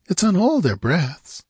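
high nervous whisper-like worry. A narrator's reading. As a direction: keep her fearful and high. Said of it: "she asked nervously".

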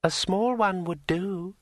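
slow, high, airy dryad voice. A narrator's reading. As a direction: tease him like a rustling tree spirit. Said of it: "said Drueli".

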